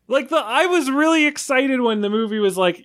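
normal talking voice

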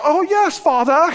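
high, sing-song "yes Father", hammed-up; mock obedient son. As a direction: play the falsely dutiful kid. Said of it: "and so he go".

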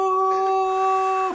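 long, stretched high hello; drawn-out hello. Retold or imitated. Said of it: "for a long time".

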